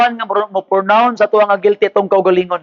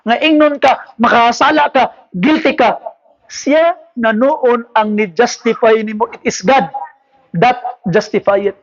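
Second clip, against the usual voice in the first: normal talking voice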